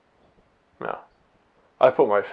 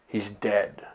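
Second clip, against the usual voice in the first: hushed dread; 5 dB quieter than usual. Retold or imitated. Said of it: "That's exactly how she said it".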